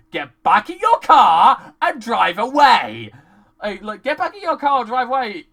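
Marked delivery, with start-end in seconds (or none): 0.1-3.1 loud, slow, spaced-out command; emphatic order. Acted. Viewer ordering the crew to leave, slow emphatic order. 4.0-5.5 quick, higher-pitched urging; hurried order. Acted. Viewer urging the crew to leave, quick impatient order.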